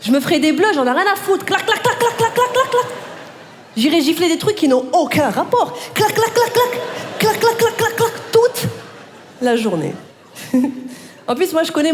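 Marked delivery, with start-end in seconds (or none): 1.4-2.9 high, laughing clac clac clac; high rhythmic clacs. Acted. Rising clac sound effect, laughing. 5.9-8.7 high rhythmic clac sound effect. Acted.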